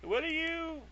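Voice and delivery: awestruck. Retold or imitated. Answering the divine voice from the sky, high and awed. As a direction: gasp it out in startled wonder. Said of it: "and I'm like".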